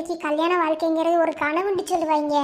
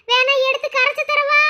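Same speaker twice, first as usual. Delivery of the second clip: teasing offer; 8 semitones higher, 4 dB louder than usual. Acted. Bride teases grandmother.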